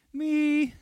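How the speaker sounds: put-on high little child's "Me"; toddler voice. Pastor imitating his toddler in the pantry, high childish squeak. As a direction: squeak it like an eighteen-month-old asking for a cookie. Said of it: "I heard my eighteen-month-old go".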